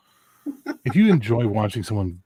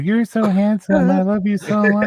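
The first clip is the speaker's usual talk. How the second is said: puppet voice; 9 semitones higher, 5 dB louder than usual. Acted. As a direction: coo like a hand puppet. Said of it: "when you gotta put the blonde puppet on your hand".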